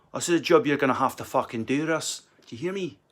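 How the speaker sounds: stern Glaswegian agent, rising on the last question. Acted. Agent telling Russ about a job, Glaswegian accent. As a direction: order the client about in Glaswegian. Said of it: "Do an Angli Glaswegian agent".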